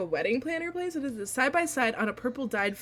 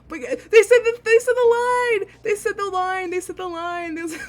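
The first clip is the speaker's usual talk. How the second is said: high, loud squeal that stretches out and fades; ecstatic fangirl; 6 semitones higher, 6 dB louder than usual. Real, not acted. Reacts to beloved line in game.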